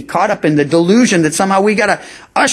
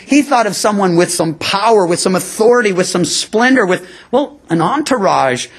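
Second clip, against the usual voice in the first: ordinary talk, nothing marked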